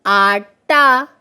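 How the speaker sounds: आटा stretched slowly, long आ; drawn-out word. Shown as a demonstration. Teacher blending letters into आटा for the class, drawn out slowly. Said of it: "टे अलिफ टा".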